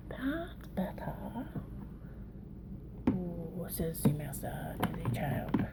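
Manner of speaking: slow hushed whisper, a mock wise master. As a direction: whisper it like a sage teaching a pupil. Playful aside while doing makeup.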